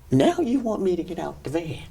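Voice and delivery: usual speaking voice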